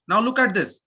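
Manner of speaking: normal talking voice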